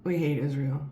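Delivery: imitating friend's casual shrug; flat matter-of-fact. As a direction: say it blandly like stating a fact. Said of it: "And he goes like this".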